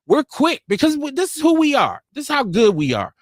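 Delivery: ordinary talk, nothing marked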